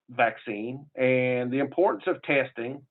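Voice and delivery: normal talking voice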